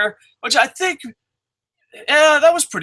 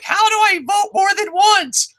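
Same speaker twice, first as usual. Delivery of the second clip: much higher, squeaky mock voice of an eager voter; a put-on high voice mocking her vote stuffing; mock Dixie Carter. Mocking Dixie Carter stuffing the ballot, in a high squeaky voice. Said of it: "She's like".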